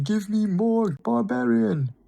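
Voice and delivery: high, hammed-up battle cry; mock Leonidas scream. Fan jumping off the couch like Leonidas, screaming. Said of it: "jumped off my couch screaming".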